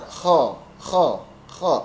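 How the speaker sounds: KHO with a freely flowing raspy fricative; flowing, rough kh. Shown as a demonstration. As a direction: let the breath scrape through "kho". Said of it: "kalau kho lancar". (kho lancar dengan suara kasar)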